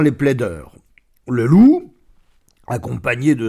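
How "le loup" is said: wolf announced loudly. A narrator's reading. Dramatic naming of the wolf.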